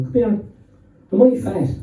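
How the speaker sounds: Limerick-style plea to the parents; Limerick fashion. Retold or imitated. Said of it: "in a Limerick fashion".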